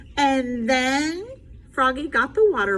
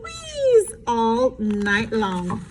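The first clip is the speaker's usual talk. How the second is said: ordinary delivery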